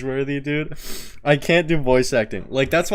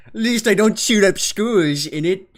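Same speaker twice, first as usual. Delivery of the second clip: high, loud mock British accent; 9 semitones higher, 7 dB louder than usual. Streamer imitating a British person's jab, British accent. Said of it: "what do British people sound like".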